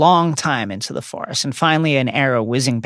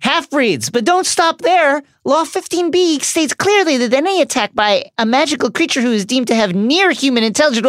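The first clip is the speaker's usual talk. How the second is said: much higher, fast, prissy hammed-up official; panicky Umbridge; 10 semitones higher than usual. Umbridge threatening the centaurs, hammed-up high and fast. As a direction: rattle off the regulations in a high panic.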